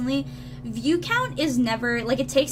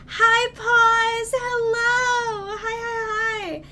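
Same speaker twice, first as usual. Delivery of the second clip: high, drawn-out singsong hello; singsong greeting; 9 semitones higher, 3 dB louder, much slower than usual. Real, not acted. Streamer greeting an arriving viewer in high singsong. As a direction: sing out the hellos high and long.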